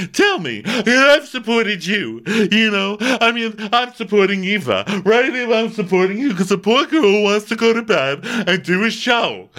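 loud, high, indignant wail; mock dramatic ranting. Dorinda scolding Jill on the couch, loud indignant wail. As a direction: rant like a wounded diva. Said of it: "she's going".

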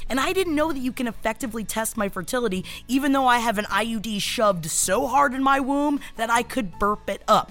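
chatty sponsor read over music. Acted. Host reads a fertility test sponsor ad to listeners. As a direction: sell it with jokey asides.

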